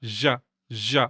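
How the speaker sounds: "Zh, zh" as a voiced sh, tongue flat to palate; voiced palatal fricative. Shown as a demonstration. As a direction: buzz "Zh, zh" against the palate. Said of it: "is the same sound, but voice".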